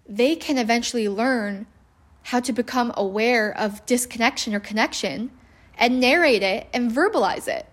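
usual speaking voice

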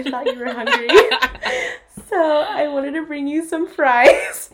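can't keep a straight face; laughing through quote. Retold or imitated. Retelling drunk offer to cop.

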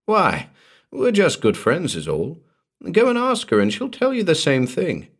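high-pitched, insistent denial; vehement protest. A narrator's reading. Walters pleading just friends to Morden, vehement. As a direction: insist on innocence, voice rising high. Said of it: "Walters said vehemently".

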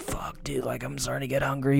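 soft whispered grumble to himself; hushed, hungry self-talk; whispered frustration. Retold or imitated. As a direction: whisper it like you don't want coworkers to hear.

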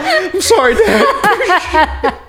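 high, laughing fake sorry; laughing mock apology. Son mock-apologising to his dad while laughing. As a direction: apologise through giggles, not meaning it.

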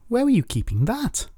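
high-pitched, incredulous question; baffled surprise. A narrator's reading. Poik sees Rhiannon produce a sack, baffled. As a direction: swoop the pitch up in bewilderment.

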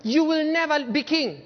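high, loud cry on "never"; king Saul scolding his son at dinner; loud Saul warning. Acted. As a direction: shout it at Jonathan as a threat. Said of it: "he says".

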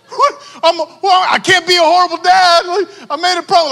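high, loud, frantic rambling; half-joking alarm, voice way up; mock panic. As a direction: play up the panicking dad. Retold panic over the costume.

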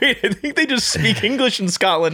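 laughing. Retold or imitated. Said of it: "I was like".

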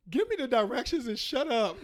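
fed up, just demand the steps; exasperated demand. Retold or imitated. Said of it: "It's like".

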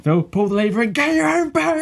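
put-on ballistic kids' host, very high screech; Dave Benson Phillips. Kids' show host egging on a child, going ballistic. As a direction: lose it completely in a high, frenzied cry. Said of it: "he would go absolutely ballistic".

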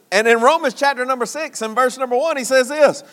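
ordinary talk

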